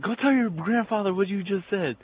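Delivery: high-pitched imitation of an angry mom; scolding mom. Angry mom orders son.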